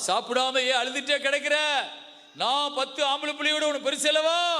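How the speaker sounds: high, preached-out husband's plea; husband's impassioned question. Acted. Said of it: "புருஷன் வந்து கேக்குறான்". (உயர்ந்த குரலில் ஆவேசக் கேள்வி)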